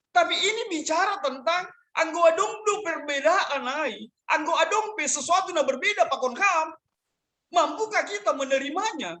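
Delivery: high, emphatic pulpit exhortation; preaching. Acted. Pastor teaching the congregation what gentleness means, preaching.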